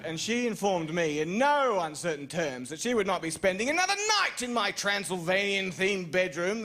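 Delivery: normal talking voice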